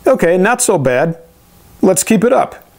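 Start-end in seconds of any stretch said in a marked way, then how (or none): none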